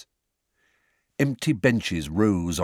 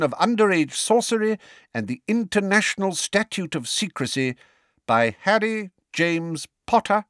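high-pitched, formal proclamation; ringing voice; 9 semitones higher than usual. A narrator's reading. Fudge announcing the charge and interrogators, ringing voice. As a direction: read out the charge and names ringingly. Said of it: "said Fudge in a ringing voice".

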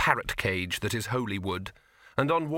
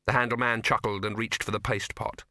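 plain reading voice

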